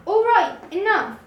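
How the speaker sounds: fed-up shout. A narrator's reading. Jupiter silencing the croaking frogs, loud and exasperated. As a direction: snap it out, sick of the noise.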